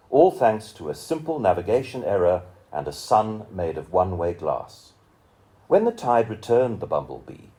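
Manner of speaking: measured, rhythmic poem recital with lingering pauses; poetry reading. Acted. Reading a friend's poem aloud to an audience, solemn recital. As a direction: let each image land, unhurried and solemn.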